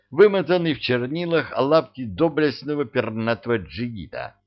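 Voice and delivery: plain reading voice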